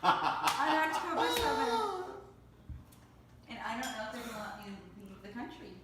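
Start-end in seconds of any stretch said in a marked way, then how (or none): 0.0-2.1 slow, laughing through the words. Real, not acted. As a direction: laugh it out, embarrassed. Confessing expired passport, laughing.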